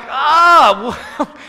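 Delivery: a very high, loud comic scream; loud high shriek at the nurse's remark; mock shocked shriek. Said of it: "And I'm like".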